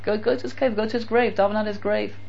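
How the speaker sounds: soft, insistent advice; hushed, repeated nudging; gentle urging. Acted. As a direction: quietly urge him along. Acted advice to visit the grave.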